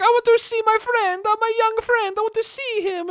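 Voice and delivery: high, pleading mock Italian accent; Italian old man. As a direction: plead in a squeaky caricature Italian voice. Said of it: "he was probably like".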